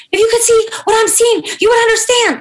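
imitating a high, loud, strained cry; high strained wail. Assistant wailing the news down the phone, high and loud. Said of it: "like, like that".

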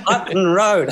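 normal talking voice

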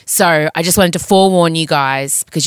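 ordinary talk, nothing marked

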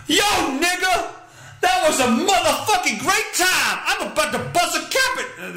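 high shouted exaggerated street accent.

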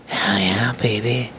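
mock sexy talk. Shown as a demonstration. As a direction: lay on the cheesy bedroom charm. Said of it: "you're gonna start talking like this".

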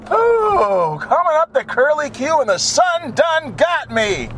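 high-pitched, hammed-up recovery; playful high exclaiming. Driver coming round the bend after sun glare, comic high exclaiming. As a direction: exclaim up high in mock distress.

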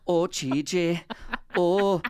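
exaggerated Welsh lilt, played for laughs; Welsh accent. Shown as a demonstration. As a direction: sing out a hammed-up Welsh accent.

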